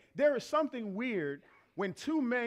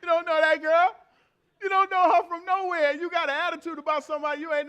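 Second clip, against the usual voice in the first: scolding; 7 semitones higher, 7 dB louder than usual. Real, not acted. Preacher scolding congregation.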